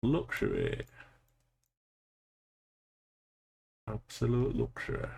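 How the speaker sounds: put-on Yorkshire accent. Streamer playing at being a Yorkshireman while gaming, Yorkshire accent. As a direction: savour it in broad Yorkshire vowels. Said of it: "my accent's starting to get a little bit more Yorkshire".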